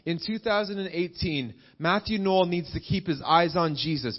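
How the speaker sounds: usual speaking voice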